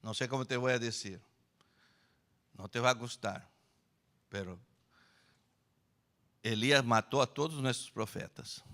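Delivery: hushed, hesitant bad news; Ahab's soft confession. Ahab tells his wife the bad news, hushed hesitant. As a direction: break it gently to your wife. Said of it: "llega Acabe y dice".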